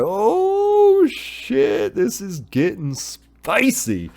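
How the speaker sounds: very high, mock gleeful surprise, slowing on the end; thrilled shock. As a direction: squeal at the twist, then savour it. Viewer reacting to alliance.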